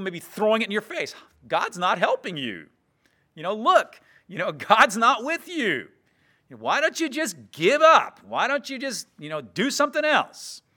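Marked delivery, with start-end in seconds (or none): 1.5-10.6 higher, louder jeering voice of scoffers; mocking taunt. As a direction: taunt the believer as a scornful doubter. Preacher voices mocking doubters.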